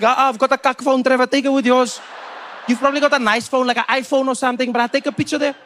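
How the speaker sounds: high, loud South African fan; insistent, high local-guy voice; pushy street fan. Acted. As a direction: talk the star into it, excitedly.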